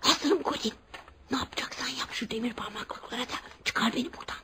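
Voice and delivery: urgent hushed whisper through the bars. Acted. Boy at his barred window, whispering to Colin to get him out. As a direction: breathe the words so no one in the house hears.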